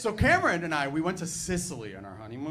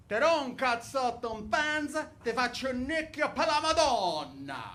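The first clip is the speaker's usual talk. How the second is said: put-on slower, higher Sicilian Italian accent; Sicilian swear; 8 semitones higher than usual. Reciting grandmother's Sicilian curse in Sicilian accent. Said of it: "I'm gonna say it in Sicilian".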